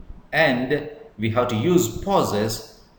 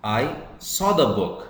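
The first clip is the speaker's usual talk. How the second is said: "saw" punched out after "I", then falling; stress on saw. Shown as a demonstration. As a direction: put the weight on "saw" in "I saw the book". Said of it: "but I did not take it".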